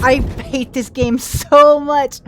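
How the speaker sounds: loud, slow angry shout; furious gamer rant. Real, not acted. Gamer raging at a hard level, loud and slow. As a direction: drag it out in real fury. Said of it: "apologize for getting really angry".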